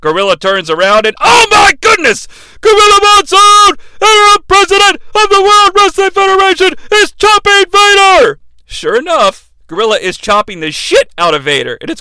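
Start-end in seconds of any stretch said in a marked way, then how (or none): 1.2-8.4 high, loud hyped call; excited announcer call. Retold or imitated. Announcer reacting to Gorilla chopping Vader, excited shout.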